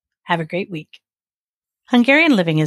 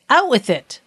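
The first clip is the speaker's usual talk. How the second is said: sharp, higher-pitched royal command; 6 semitones higher, 4 dB louder than usual. A narrator's reading. King ordering the stalling judge to answer, commanding. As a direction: order it out impatiently. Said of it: "commanded the king".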